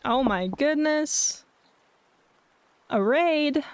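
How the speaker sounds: slow, high, stretched-out gasp of surprise; drawn-out delighted disbelief; cute anime streamer. Real, not acted. Sudden raid arrives on stream.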